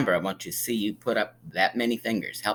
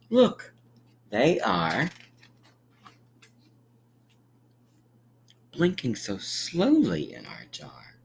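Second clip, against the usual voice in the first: sing-song picture-book read; gentle storybook narration; reading to children; 4 semitones higher, 3 dB louder than usual. A narrator's reading. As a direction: read it like storytime for little ones.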